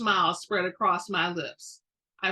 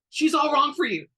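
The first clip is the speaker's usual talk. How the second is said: much louder, higher, harsh; spat out; 7 semitones higher, 9 dB louder than usual. A narrator's reading. Great-grandmother to Evan about Macy, spat out. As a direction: spit the words out before thinking. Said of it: "I spat out".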